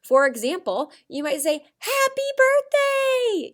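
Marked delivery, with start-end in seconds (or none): 1.8-3.5 much higher, slow and loud celebration; excited. Shown as a demonstration. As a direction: sing out the birthday wish with joy. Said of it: "shows a strong emotion".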